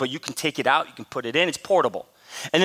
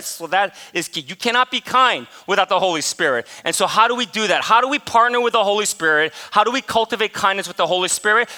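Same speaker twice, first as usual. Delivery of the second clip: usual speaking voice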